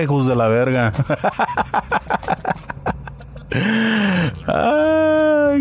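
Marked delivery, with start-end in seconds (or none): 0.9-4.4 long high cackle, softer; high cackling laugh. Real, not acted. Laughs after telling viewers off. 4.5-5.6 high squeal. Real, not acted. Squeals after laughing.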